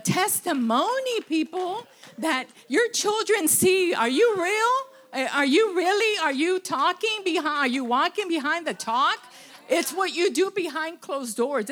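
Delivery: preaching build-up. Real, not acted.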